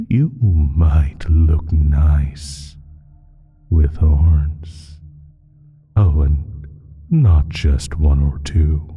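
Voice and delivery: ordinary talk, nothing marked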